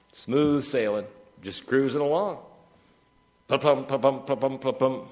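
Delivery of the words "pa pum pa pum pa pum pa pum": rhythmic pa-pum. Retold or imitated. Imitating wheels rolling smoothly.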